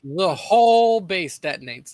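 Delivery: slow, loud and dramatic; dramatic announcement. Pretend. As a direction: boom it out slowly. Player dramatizes explosion.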